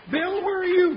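loud call into darkness. Acted. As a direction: shout for your partner. Calling out in the dark.

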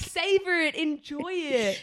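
high, slow and coaxing. Retold or imitated. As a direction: urge her gently to slow down. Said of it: "I'm like".

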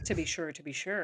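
a lightly put-on Irish brogue; Irish accent. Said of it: "As my Irish heritage suggests".

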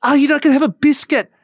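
shrill-free teasing Irish squeal of disbelief; hammed-up Irish hosts mocking the guest. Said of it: "and they'd be like".

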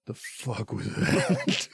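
low, slow, groggy mock voice; lightly voiced groggy grumble; mock Levi. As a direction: grumble it half-awake. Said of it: "he's like".

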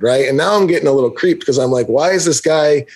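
ordinary talk

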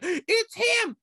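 high, gasped-out discovery; mock dramatic gasp; excited reveal. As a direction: point him out in awe. Crowd spotting silhouette.

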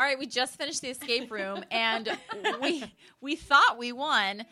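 laughing through the words. Real, not acted. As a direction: talk while laughing. Laughing telling escape fail.

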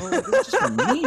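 high, loud, laughing objection; mock protest. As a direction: protest in a squeaky, embarrassed voice. Embarrassed protest in public, laughing.

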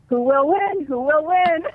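put-on high, excited little boy chant; toddler voice. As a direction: sing it out like a racing toddler. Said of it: "he just starts going".

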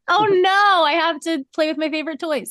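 high mock-wailing sob voice; fake crying. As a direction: wail it like a fake tragedy. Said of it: "boo-hoo".

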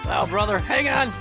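ordinary talk

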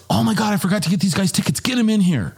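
high, rushed exclamation; acted-out alarm at having forgotten; retold sudden realization. Tony remembers forgotten tickets.

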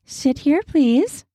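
high, sweet and inviting female voice; sweetly. Acted. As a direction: coax him kindly into the chair. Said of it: "she said sweetly".

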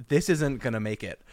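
ordinary talk

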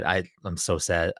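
ordinary delivery

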